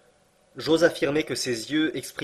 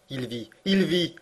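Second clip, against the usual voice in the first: higher, joyful exclamation; cry of joy; 4 semitones higher than usual. A narrator's reading. Uncle cries with joy that Axel lives, higher. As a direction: burst out in relief. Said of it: "s'écria-t-il".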